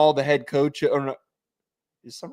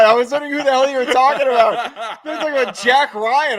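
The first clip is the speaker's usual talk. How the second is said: talking through laughter; laughing; 9 semitones higher, 5 dB louder than usual. Real, not acted. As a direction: laugh the words out.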